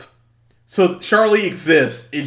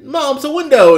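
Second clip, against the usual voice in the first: exasperated daughter; 10 semitones higher, 10 dB louder than usual. Retold or imitated.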